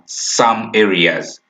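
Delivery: slow, weighted "some areas"; emphasizing some areas. Shown as a demonstration. Teacher stressing "some areas" for the class, slowly. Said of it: "I want also to emphasize the point here".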